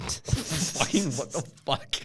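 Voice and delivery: drawn-out, high, stunned what-the-fuck; stunned disbelief. Retold or imitated. Friends listening in on the call, slow stunned disbelief. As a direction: drag it out like you can't believe your ears.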